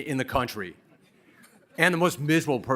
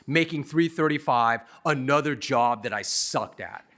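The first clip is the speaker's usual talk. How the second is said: ordinary talk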